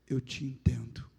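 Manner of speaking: low, whispered reassurance; divine voice. Retold or imitated. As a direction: voice of God, hushed and deep, consoling. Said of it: "E a voz disse assim". (voz divina sussurrada e grave)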